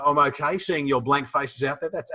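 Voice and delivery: ordinary talk, nothing marked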